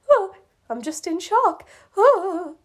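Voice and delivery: high, melodramatic gasp of shock; mock shock. Marshall excuses his reaction, in hammed-up shock. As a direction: overplay the shock with breathy ohs.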